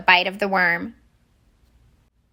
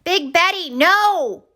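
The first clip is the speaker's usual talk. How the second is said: high, louder desperate cry; shouted warning; 10 semitones higher, 4 dB louder, slower than usual. Acted. Alfonso warning Big Betty off the hook, loud high shout. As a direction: yell to stop her in time. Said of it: "Alfonso shouted".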